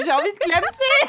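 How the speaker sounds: mock dramatic wail. Mock despair, measured loud and high.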